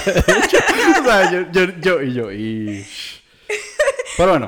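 loud laughing, stumbling words. Real, not acted. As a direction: laugh while trying to talk. Laughing at cracked voice. (riéndose)

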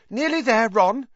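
high-pitched urgent reassurance; encouraging girl. A narrator's reading. Hermione encouraging sick Ron.

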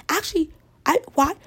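exaggerated mock comeback; mock snap. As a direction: bite back at the critic, hammed up. Snapping back at imagined critic.

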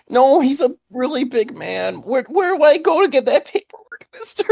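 put-on very high, pleading little kid voice; hammed-up child begging; fake crying child. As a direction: keep up the lost-child act. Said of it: "I start crying a little bit".

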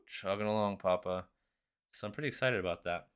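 usual speaking voice

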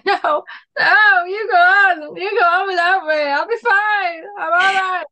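high, loud, hammed-up tearful farewell; dramatic dying hero. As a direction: ham up a movie hero left behind. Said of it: "like a scene in a movie".

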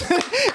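burst of laughter. Real, not acted. Laughing at own story.

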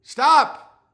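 sudden loud put-on shout; mock outburst. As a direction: burst out loudly, waving off the attention. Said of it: "I'm like".

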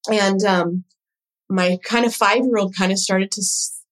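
usual speaking voice

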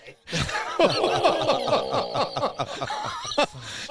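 long, high laugh at his own joke. Real, not acted. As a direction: laugh hard after the punchline.

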